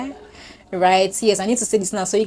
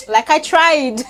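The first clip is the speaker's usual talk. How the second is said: laughing; 9 semitones higher, 7 dB louder, slower than usual. Real, not acted. Proud of finishing the year.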